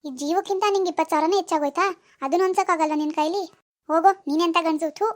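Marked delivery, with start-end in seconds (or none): 4.9-5.1 short scornful spitting "thoo"; contemptuous spit. Acted. Girlfriend dumping her boyfriend, spitting "thoo" in contempt. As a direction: spit the sound at him in disgust.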